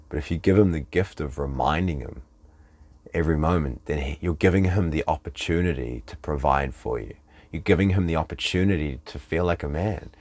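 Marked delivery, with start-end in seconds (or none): none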